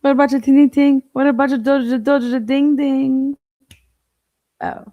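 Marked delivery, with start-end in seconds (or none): 0.0-3.4 loud, high sing-song teasing; playful high-pitched mocking chant; mock silly voice. Streamer teasing with nonsense words.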